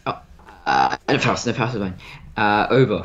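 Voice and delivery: ordinary talk, nothing marked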